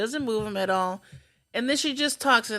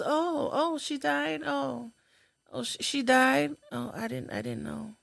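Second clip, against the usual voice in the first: hushed, higher imitation of a surprised lady; soft dismayed reply; 5 semitones higher, 6 dB quieter than usual. Lady next to her hearing the news, softly dismayed imitation. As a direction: take the news softly, trailing down. Retold neighbour reacting to death news.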